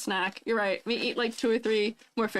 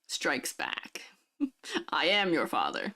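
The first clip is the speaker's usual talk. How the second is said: a lower, hammed-up Vader line through the bottle; Darth Vader; 5 semitones lower, 3 dB quieter, slower than usual. Streamer answering chat's Vader joke with a mock villain voice. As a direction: play the dark lord, half in jest. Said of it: "Darth Vader, is that you?".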